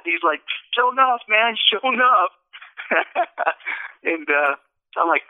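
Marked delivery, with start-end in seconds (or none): 0.8-2.3 amazed delight. Retold or imitated. Second man feels leg shrink. 2.8-4.0 breathless high laugh. Real, not acted. Laughing after the healing story.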